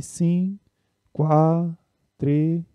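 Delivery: "sim", "quá", "tre" as separate held syllables; clipped number words "sim", "quá", "tre", spaced apart; one syllable per number. Shown as a demonstration. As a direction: give "sim", "quá", "tre" one even syllable each, second syllable dropped. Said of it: "pronunciar uma sílaba".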